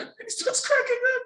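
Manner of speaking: squeaky, high voice breaking into laughter; laughing retelling. Retold or imitated. Telling how the serious guy gives in, laughing high. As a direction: tell it through rising giggles.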